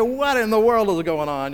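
high, loud mock outrage; shocked parent. Parent hearing little one swear, acted loud shock. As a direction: exclaim like an aghast parent.

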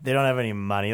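put-on low, soft drunken mumbling; lightly voiced drunk muttering to himself. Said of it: "he's muttering to himself".

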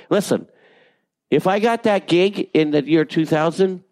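ordinary delivery

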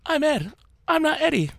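very high squeaky little-kid impression; exaggerated squeaky imitation of a friend; mocking toddler voice. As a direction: tease with a tiny high-pitched child voice. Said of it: "makes you sound like a toddler".

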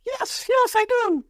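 very high, squeaky excitement; starstruck. Retold or imitated. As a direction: squeal like a fan meeting his hero. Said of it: "I go".

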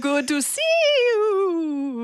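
slow, high ghostly croon; drawn-out spooky ghost. Pretend. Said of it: "ghost impression".

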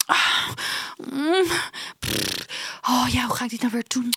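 mock dramatic sighs and huffs; theatrical sighing. Classmate fishing for a reaction, mock theatrical sighs. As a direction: ham up a bid for attention. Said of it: "dit was zo theatraal".

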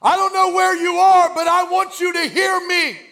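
high, sustained, full-force shout; crying out everywhere. Acted. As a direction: holler to someone you can't locate. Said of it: "he starts scattering it like birdshot".

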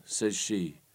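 plain reading voice

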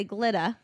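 "glitter" said as "glitt-ah"; dropped R. Retold or imitated. Quoting mom's New York speech, "glitter" without its R. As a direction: drop the final R in "glitter". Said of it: "my mom will say".

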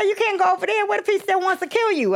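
high alarmed imitation; mock warning. The woman warning her the ex may still want to kill her, high mock alarm.

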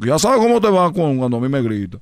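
ordinary talk

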